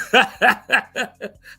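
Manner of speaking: loud burst of laughter; laughing hard at the burn; real laugh. Real, not acted. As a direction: crack up for real. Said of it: "it made me laugh".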